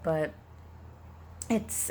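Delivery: usual speaking voice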